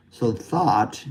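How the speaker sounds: thought with an open unrounded ah vowel. Shown as a demonstration. Teacher showing how she says thought. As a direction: say thought with the jaw open, no lip rounding. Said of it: "I say thought like this".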